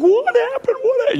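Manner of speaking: very high, frantic mother voice; imitated motherly panic, high-pitched; panicked mother. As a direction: squeal it like an alarmed mom. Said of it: "You know, the mother thing".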